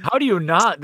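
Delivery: ordinary delivery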